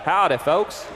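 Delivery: put-on Southern American greeting; playful American Southern accent. Shown as a demonstration. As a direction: try on a Southern drawl accent. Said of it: "He'd put on his southern accent".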